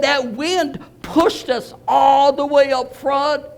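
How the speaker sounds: high, slow awed telling; awed retelling. Retold or imitated. Convert describing the wind's push, high and slow. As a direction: stretch out pushed with wonder. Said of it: "He said".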